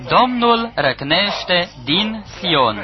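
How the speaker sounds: slow, grave scripture declaration; slow solemn proclamation. Acted. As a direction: draw each word out slowly, weighty. Verse quoted again for emphasis.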